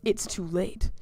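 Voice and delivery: ordinary delivery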